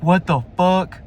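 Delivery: taken aback, pitch and volume up; shocked disbelief. Retold or imitated. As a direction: react in loud disbelief. Retold shock at teacher's advance.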